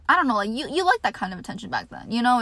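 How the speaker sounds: ordinary talk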